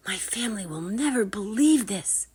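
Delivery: whispered inner thought. Acted. Drake thinks in awe, whispered to himself. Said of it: "he thought".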